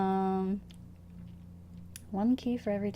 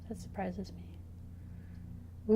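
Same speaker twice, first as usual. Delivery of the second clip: whispering; 10 dB quieter, much slower than usual. Real, not acted. Quiet surprised aside.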